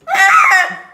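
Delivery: sharp loud "ah"; a quick startled cry; startled yelp. Real, not acted. Sudden exclamation while pouring.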